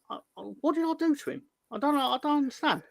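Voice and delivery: high-pitched plaintive protest; mock bewilderment. Reacting to a threat of fried mozzarella sticks, comically bewildered. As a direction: protest your innocence, baffled and wounded.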